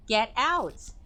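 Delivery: high, forceful get out; mild shrieked command; sharp shout. Acted. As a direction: order them out. Clarissa shouting.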